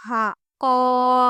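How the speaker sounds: teacher drawing out قاف from the throat; throaty qaaf. Shown as a demonstration. Teacher demonstrating a throaty قاف to students. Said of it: "حلق سے آواز آنی چاہیے".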